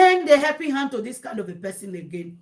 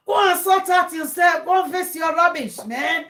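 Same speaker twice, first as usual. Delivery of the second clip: raised, loud retold rant; angry scolding; 6 semitones higher, 3 dB louder than usual. Viewer yelling at women on live videos, angry scolding. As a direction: bark orders as if fed up. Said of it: "This is why I'm always angry".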